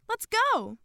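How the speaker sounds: high, loud rallying call; Madeline insisting. A narrator's reading. Girl rushing friends into the soundboard, insistent yell. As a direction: urge everyone to move now. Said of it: "Madeline insisted".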